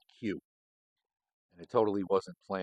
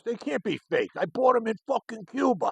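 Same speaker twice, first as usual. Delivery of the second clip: much higher, louder indignant put-on voice; indignant Danny; 5 dB louder than usual. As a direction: bark back, offended and baffled. Danny insisting cigars real.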